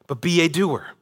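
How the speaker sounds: ordinary talk, nothing marked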